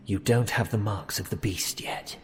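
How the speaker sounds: hushed unvoiced whisper, leaning close. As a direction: whisper the verdict as a secret. Creepy old man inspects them.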